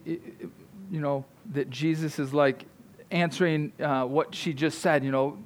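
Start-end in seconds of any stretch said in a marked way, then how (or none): none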